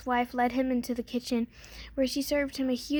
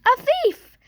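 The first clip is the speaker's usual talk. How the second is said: much higher, far louder alarm scream; 13 semitones higher, 11 dB louder than usual. A narrator's reading. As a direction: shriek out the alarm. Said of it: "the harp screamed".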